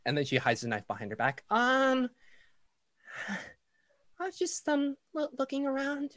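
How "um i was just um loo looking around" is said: put-on high, hesitant child voice; a caught kid stammering; shy little girl.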